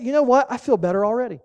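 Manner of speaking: ordinary delivery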